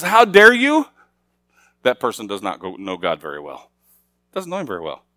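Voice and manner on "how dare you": higher, louder "How dare you?" with a rise on "dare"; scolding challenge. Retold or imitated. Person shaking a finger at God, higher and louder. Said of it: "shakes his finger and says".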